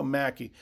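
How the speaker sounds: Mackey said MACK-ee, rhyming with "tacky"; short "a" in the first syllable of Mackey; correct pronunciation. Shown as a demonstration. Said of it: "it's pronounced Mackey".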